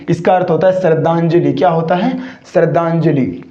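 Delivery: ordinary delivery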